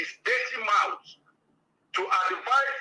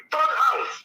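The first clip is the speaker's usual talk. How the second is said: ordinary delivery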